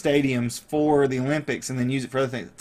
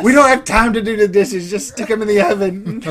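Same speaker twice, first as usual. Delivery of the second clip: frantic host; 6 semitones higher, 8 dB louder than usual. Pretend. Frantic host voiced cleaning up.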